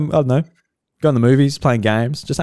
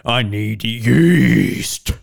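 drawn-out; much slower than usual. Acted. Joking drawn-out demand.

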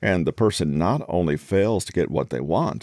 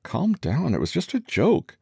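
high, defensive wounded protest; hurt and confused; 10 semitones higher than usual. Acted. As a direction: play it innocent and hurt. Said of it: "can act hurt and confused".

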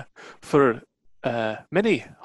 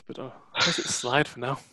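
normal talking voice

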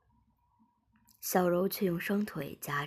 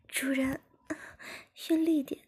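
high-pitched character voice broken by moans; moaning while speaking; 9 semitones higher than usual. A narrator's reading. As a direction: speak much higher, moaning between phrases. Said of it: "边呻吟边说". (边呻吟边说，声音很高)

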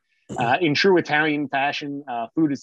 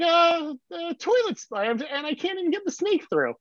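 exasperated dad; 14 semitones higher than usual. Retold or imitated. Dad describing the clogged toilet.

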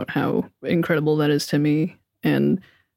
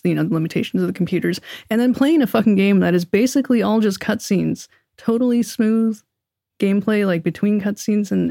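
ordinary talk, nothing marked

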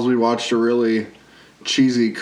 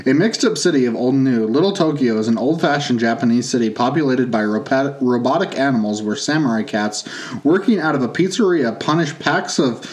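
usual speaking voice

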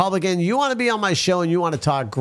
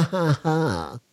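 laughing; slower than usual. Real, not acted. Cracks up after joke.

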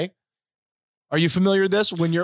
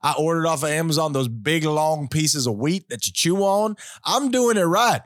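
put-on Southern accent. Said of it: "It's not a terrible Southern accent".

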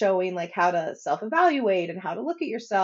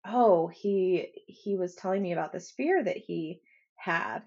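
ordinary talk

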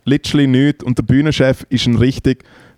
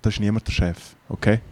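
low, hushed Bernese put-down; quietly dismissive mock Bernese; imitated Bernese German; 3 semitones lower, 8 dB quieter, faster than usual. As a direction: imitate a Bernese stage manager, very softly. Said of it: "einfach auf Berndeutsch, das kann ich nicht so gut nachmachen".

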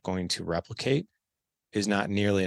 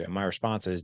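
ordinary delivery

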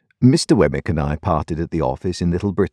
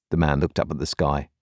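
ordinary delivery

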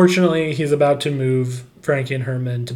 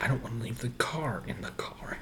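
whispering; 2 semitones lower, 15 dB quieter than usual. Retold or imitated. Mocking the husband's reply.

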